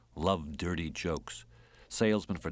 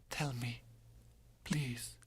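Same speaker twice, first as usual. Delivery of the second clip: higher, hushed pleading whisper; whispered plea; 5 semitones higher, 10 dB quieter, slower than usual. Acted. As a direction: beg him in a whisper. Dying lover begs softly.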